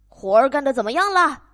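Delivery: loud, fast, impatient farmer; 农夫又快又响地催问; fast farmer yell. Acted. Farmer yells faster.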